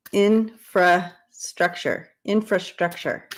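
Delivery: Infrastructure spelled out slowly, syllable by syllable; careful pronunciation. Shown as a demonstration. Showing viewers she can say infrastructure. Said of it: "I have to, okay".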